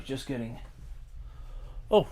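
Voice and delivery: normal talking voice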